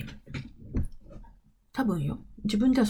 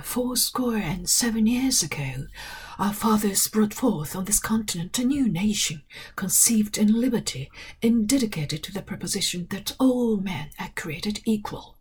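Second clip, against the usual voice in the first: slow, measured British-accented reading of the Gettysburg Address; British English recitation; slower than usual. Shown as a demonstration. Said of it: "お手本なしで喋ると、イギリス英語になっちゃう". (イギリス英語でゆっくり朗読)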